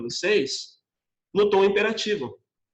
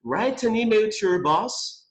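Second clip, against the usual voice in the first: slow clear model; 5 semitones higher, slower than usual. Shown as a demonstration. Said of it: "Como eu coloquei na segunda frase".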